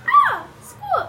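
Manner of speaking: shrill, loud, high squawk; parrot squawk. Acted. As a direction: screech like a terrified parrot. Parrots screech in terror.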